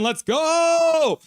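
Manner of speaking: high, loud drawn-out cheer; whooping victory shout. Real, not acted. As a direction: holler it, stretching the final word. Cheering the team's win.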